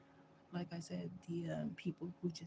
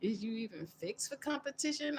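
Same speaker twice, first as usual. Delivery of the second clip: a touch of a mock challenge, higher; lightly voiced dismissive comeback; sassy retort; 5 semitones higher than usual. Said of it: "I'm like".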